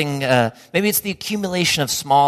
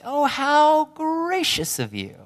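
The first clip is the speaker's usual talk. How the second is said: much higher, slow, sing-song politeness; polite gracious host; 13 semitones higher, slower than usual. Pretend. Host thanking a dinner guest for a candle, mock-gracious and high. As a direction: gush like a host handed a gift. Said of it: "You say".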